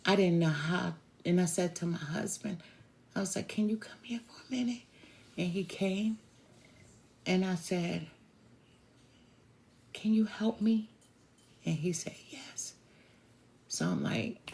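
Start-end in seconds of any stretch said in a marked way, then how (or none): 3.5-4.8 hushed urgent whisper; fast whispered summons. Retold or imitated. Quietly calling husband near naked uncle. 9.9-10.9 hushed whisper. Retold or imitated. Whispered request beside the aunt. 12.3-12.7 barely audible whisper; hushed reply; whispered yes. Retold or imitated.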